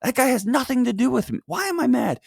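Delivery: high-pitched, worked-up self-questioning; acted exasperation. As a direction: puzzle aloud over your anger, pitched high. Acts out questioning road rage.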